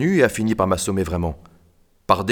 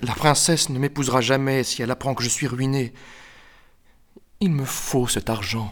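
exclaiming; 4 semitones higher than usual. A narrator's reading. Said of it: "Le comte s'exclama".

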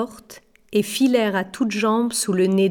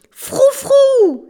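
put-on very high, loud child's cry; children's exclamation; 9 dB louder than usual. A narrator's reading. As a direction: call out like excited children. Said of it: "s'exclamèrent les enfants". (exclamation d'enfants, très aiguë et forte)

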